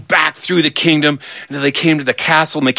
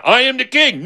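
much higher, agitated claim; heated insistence; 11 semitones higher than usual. Acted. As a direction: pitch it way up, arguing back. Argument escalates.

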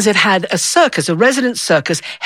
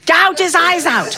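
mock yell; 9 semitones higher than usual. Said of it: "this one was yelling".

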